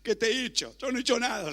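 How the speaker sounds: high, pleading acted protest; anguished crying out. Job protesting his innocence to the Lord, anguished crying out. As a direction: cry out in protest, pitch jumping up. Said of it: "gritó, clamó, dijo".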